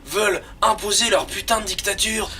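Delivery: high-pitched TV presenter impression; Jamie voice. Co-host sets up Jamie with a question, high presenter voice. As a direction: pipe up high like an eager science-show host. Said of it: "fais-la avec la voix de Jamie".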